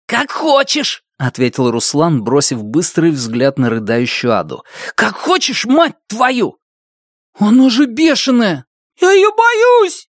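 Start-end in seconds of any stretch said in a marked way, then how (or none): 0.1-1.0 tense. Acted. Tense quarrel over a dog. 5.0-6.6 cursing, high and tense; angry. Acted. 7.4-10.1 terrified. Acted. Terrified boy by a dog.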